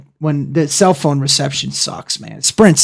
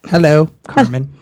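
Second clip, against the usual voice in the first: put-on British accent; 2 semitones lower than usual. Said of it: "It's so proper".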